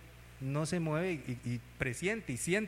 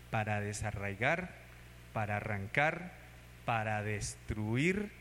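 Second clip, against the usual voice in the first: solemn, low and slow; 5 semitones lower, slower than usual. Acted. Preacher quoting God's words.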